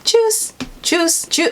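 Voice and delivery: very high, sung-out Tschüss; Tschüss in a very high, singing voice; high-pitched sing-song. Shown as a demonstration. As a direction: sing it high like a cheerful goodbye. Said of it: "such a high-pitched voice".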